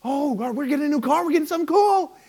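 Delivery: breathless, rising excitement; excited crowd. Retold or imitated. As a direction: gasp then gush like a thrilled audience member. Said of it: "everybody in the crowd just went".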